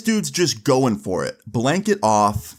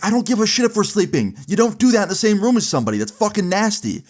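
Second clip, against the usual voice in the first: retold angry yelling; 11 semitones higher than usual. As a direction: really let him have it, voice raised high. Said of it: "like, raising my voice".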